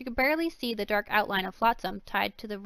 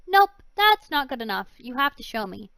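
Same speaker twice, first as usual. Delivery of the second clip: insistent; 3 semitones higher, 9 dB louder than usual. Acted. Girl insists.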